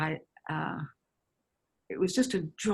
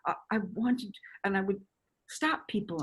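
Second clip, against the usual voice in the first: normal talking voice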